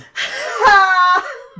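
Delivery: high loud laugh; squealing laugh. Real, not acted. Host laughing at the guard line, high squeal. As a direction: crack up in a squeal. Cracking up.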